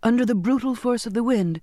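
usual speaking voice

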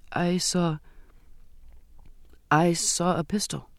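halting, with a long pause after a false start; hesitant confession. A narrator's reading. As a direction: break off, then restart reluctantly. Reluctant girl confesses to captain.

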